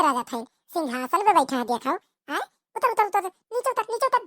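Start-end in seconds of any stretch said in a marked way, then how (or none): 2.8-4.2 higher, sharp orders to climb down; a mother barking at her son on the roof; angry mother yelling. Acted. (ऊँची आवाज़ में डाँटती माँ)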